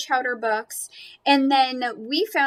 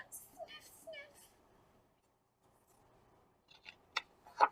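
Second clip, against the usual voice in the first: gasp and sniffs; 22 dB quieter than usual. Acted. Lucy catching Squeaker's scent.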